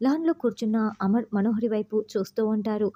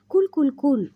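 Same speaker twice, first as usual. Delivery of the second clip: raised, louder "cool, cool, cool"; calming interjection; 5 semitones higher, 6 dB louder than usual. A narrator's reading. Manohari halting Amar's flood of questions, raised calming voice. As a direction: halt the questions with higher, louder repeats.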